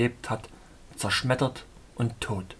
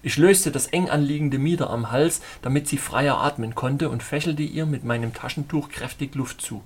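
ordinary delivery, nothing marked

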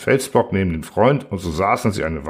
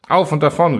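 loud, higher urgent call; gerufen; 7 semitones higher, 5 dB louder than usual. A narrator's reading. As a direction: call out the alarm to flee. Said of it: "rief er". (laut und hoch gerufen)

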